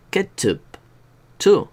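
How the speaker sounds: ch as in "ketchup"; ch sound. Shown as a demonstration. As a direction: punch the ch in "chu". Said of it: "no es lo mismo que hacer chu".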